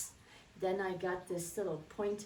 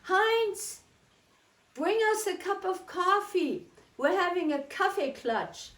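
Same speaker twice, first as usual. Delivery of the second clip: much higher, louder call to Heinz; wife calling for coffee; 9 semitones higher, 6 dB louder than usual. Retold or imitated. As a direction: call from the next room for coffee. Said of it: "she's gonna say".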